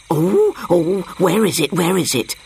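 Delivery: lightly voiced excited monkey; hoot, then ask eagerly twice over; a touch of eager monkey chatter; getting excited. A narrator's reading. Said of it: "getting excited".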